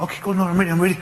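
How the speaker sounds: put-on high, eager young player; bursting to get on the pitch; eager reply. Young player answering the coach, high and eager. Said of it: "So I'm".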